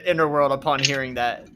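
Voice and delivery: ordinary talk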